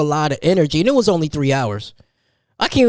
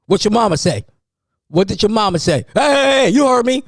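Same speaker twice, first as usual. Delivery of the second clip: stepdaddy voice; 7 semitones higher than usual. Retold or imitated. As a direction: yell like a strict stepdad getting the kids in line. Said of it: "That's my best stepdaddy voice".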